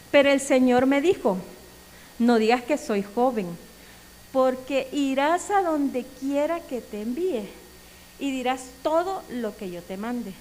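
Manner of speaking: loud, deliberate scripture reading; lectura de versículo. Acted. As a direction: read the verse out firmly, stretching key words. Reads the Bible verse aloud. (lectura bíblica fuerte y pausada)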